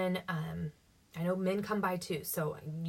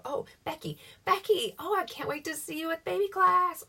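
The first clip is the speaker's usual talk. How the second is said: gushing greeting; 10 semitones higher, 4 dB louder than usual. Shown as a demonstration. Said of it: "look at her name and go".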